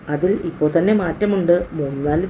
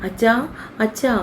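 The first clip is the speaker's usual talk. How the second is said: mildly acted wail for father; rising call, second one higher; understated cry for help; tearful cry out; 5 semitones higher than usual. A narrator's reading. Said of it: "നിലവിളിച്ചുകൊണ്ട് പൊട്ടിക്കരഞ്ഞു".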